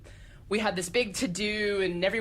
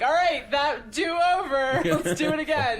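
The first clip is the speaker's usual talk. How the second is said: excited announcer; 9 semitones higher, 5 dB louder than usual. Retold or imitated. Host announcing the do-over to the crowd, loud and high. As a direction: hype the crowd for the rerun. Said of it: "it's like".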